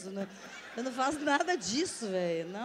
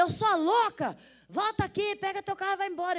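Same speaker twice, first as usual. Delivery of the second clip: higher, louder retold call, quickening at the end; 9 semitones higher, 6 dB louder than usual. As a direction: yell after her to come back. Robber calling her back.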